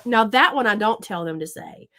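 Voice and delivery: usual speaking voice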